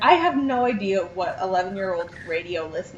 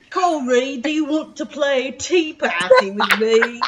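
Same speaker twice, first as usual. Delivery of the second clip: higher-pitched mock Aussie little girl; Australian accent; 4 semitones higher, 3 dB louder than usual. Co-host joining in as the sister inviting Corey, higher Australian accent. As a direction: play an Australian kid asking a playmate. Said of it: "in her Australian accent".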